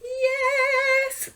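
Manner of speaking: high sing-song yes dissolving into laughter; laughing cheer. Real, not acted. Teacher cheering herself mid-massage, laughing. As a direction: let the yes ring up high and break into a laugh.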